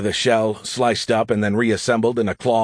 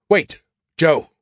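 higher, urgent appeal; pleading; 6 semitones higher, 4 dB louder than usual. Acted. AI stopping Joe before a fatal move, pleading. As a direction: beg him to hold on. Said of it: "Skippy pleaded".

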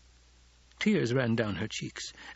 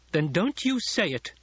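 high, firm command; ordering; 7 semitones higher, 3 dB louder than usual. A narrator's reading. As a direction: order her firmly. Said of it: "Hara ordered".

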